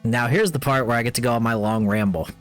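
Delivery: ordinary talk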